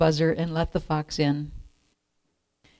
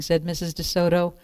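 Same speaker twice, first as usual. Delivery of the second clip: ordinary delivery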